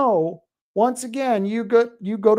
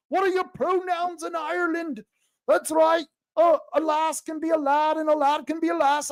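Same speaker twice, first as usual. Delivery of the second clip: hammed-up Irish accent, higher pitched; cheerful mock Irish accent, pitched up; 7 semitones higher than usual. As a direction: play a jolly stage Irishman with an Irish accent. Mock Irish voice about Ireland.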